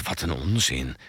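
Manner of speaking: low, quiet grumble; hushed dismissive murmur; soft mumbling. A narrator's reading. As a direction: mutter it under your breath. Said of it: "En zacht mompelend".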